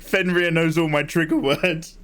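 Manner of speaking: usual speaking voice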